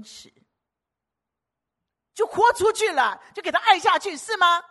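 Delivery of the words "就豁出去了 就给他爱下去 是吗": much higher, louder, all-out preaching; fiery preaching. Acted. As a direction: throw everything into the call. Preaching at full force. (激昂高声讲道)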